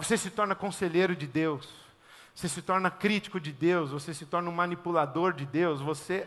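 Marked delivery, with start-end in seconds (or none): none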